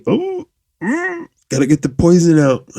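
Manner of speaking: very high, strained mock groan of urgency; strained joking. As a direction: squeal it out like it's bursting to escape. Joking about abstinence urges.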